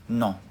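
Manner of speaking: ordinary talk, nothing marked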